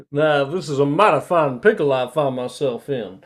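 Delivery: loud, hammed-up Southern gentleman; Southern accent. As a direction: lay the Southern charm on thick. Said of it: "is what Lilith can hear".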